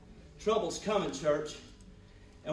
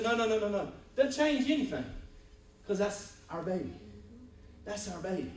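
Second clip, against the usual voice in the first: insistent refusal; 6 semitones higher than usual. Retold or imitated. Father telling doctor the baby stays, firm then tender.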